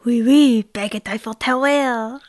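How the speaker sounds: loud, hammed-up French accent; jokey fake French "oui, oui, baguette". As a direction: play a cartoon Frenchman for laughs. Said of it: "the only thing I can say in a French accent".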